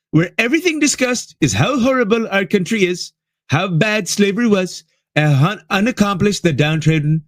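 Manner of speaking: mock the post as the president would say it; Trump voice. Reading the president's post in a mocking Trump voice. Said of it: "I have to do it in his voice".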